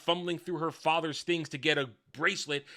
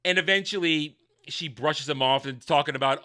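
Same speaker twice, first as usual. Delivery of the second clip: usual speaking voice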